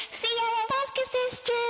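high farewell shout. Acted. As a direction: call back while running away.

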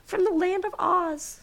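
high, slow, hammed-up solemn line; mock grave Dorothy. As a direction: ham up Dorothy's gravity, pitched high and drawn out. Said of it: "said Dorothy gravely".

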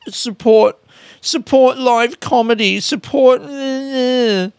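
high, pleading mock whine; mocking a meek plea for support; meek begging. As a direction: beg for a handout in a high, pitiful voice. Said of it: "meek begging for a handout".

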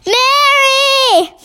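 high, drawn-out cry of the name; sharp, high exclamation; sharp call. A narrator's reading. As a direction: call her sharply, pitched right up. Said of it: "saying rather sharply".

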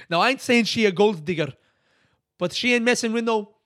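mock Greek accent, loud and high; sing-rap it in a Greek accent. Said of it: "in a Greek accent".

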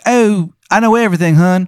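high, loud, hammed-up Maryland accent; overdone Maryland accent, loud and high; terrible Maryland accent. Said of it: "His accent is so bad".

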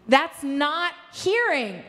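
normal talking voice

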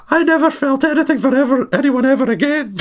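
imitating the nanny's high, pious lament; hammed-up high old-lady vow; Mrs Doubtfire's voice. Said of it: "Daniel Hillard's like".

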